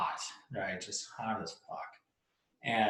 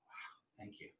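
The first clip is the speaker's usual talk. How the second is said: very soft, almost whispered gratitude; hushed relief; 24 dB quieter, slower than usual. Acted. Traveler stepping into air conditioning, hushed relief. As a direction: let it out on a breath, barely audible.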